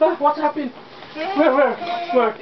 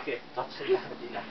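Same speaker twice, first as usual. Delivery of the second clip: softer whispered aside, barely voiced; 7 semitones lower, 14 dB quieter, much faster than usual. Real, not acted. Mum whispers to the child beside the sleeping Ila, hushed whisper. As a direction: breathe it so the sleeper stays asleep. Hushing near the sleeping doll.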